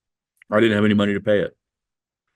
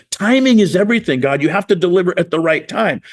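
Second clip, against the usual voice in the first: much higher, scolding insistence; indignant lecture; 8 semitones higher, 3 dB louder than usual. Retold or imitated. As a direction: lay down the law to God. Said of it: "And so I'm saying to him".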